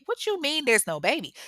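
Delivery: high-pitched acted incredulity; indignant mock disbelief; disbelieving. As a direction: challenge it as if it's absurd. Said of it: "not trying to hear that".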